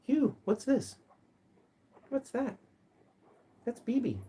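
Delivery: high sing-song pet talk; high cooing voice for the pet. Real, not acted. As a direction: coo up high at the bird.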